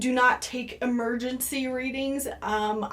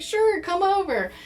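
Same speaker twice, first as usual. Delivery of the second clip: eager yes; 8 semitones higher, 3 dB louder than usual. Retold or imitated. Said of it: "I was just like".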